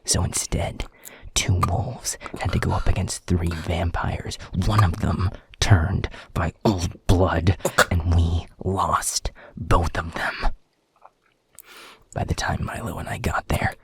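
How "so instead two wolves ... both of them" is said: loud, angry blame, key words hit hard; bitter accusation. Acted. Alpha blames subordinate for two lost wolves, bitterly angry. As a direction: throw the blame at them forcefully.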